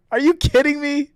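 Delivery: high-pitched incredulous outburst. Real, not acted. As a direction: burst out in real disbelief. Disbelief at viewers' guesses of his accent.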